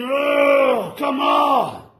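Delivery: high, loud fed-up shout; a mock groan of disbelief; exasperated cry. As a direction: throw up your hands and cry it out. Exasperated cry he keeps hearing.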